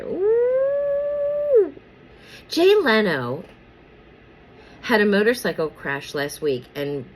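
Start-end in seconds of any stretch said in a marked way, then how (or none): 0.0-1.7 long, very high drawn-out ooh; juicy ooh. Real, not acted. Vlogger reacts to [name]'s dirt on [name], drawn-out ooh. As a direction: savor the scandal with a slow, soaring ooh.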